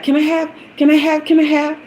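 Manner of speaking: high, fast, eager pleading; excited begging. Retold or imitated. To her love at Panera, giddy and begging. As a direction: beg like a giddy kid for the treat. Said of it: "just all excited".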